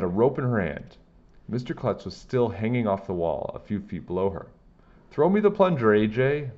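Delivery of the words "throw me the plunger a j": high, loud call down from the roof; yelling. A narrator's reading. Miss Lazar on the roof yells to A J, high and loud. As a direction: shout it down to the kid below. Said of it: "Miss Lazar yelled".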